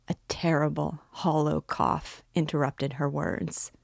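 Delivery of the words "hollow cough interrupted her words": plain reading voice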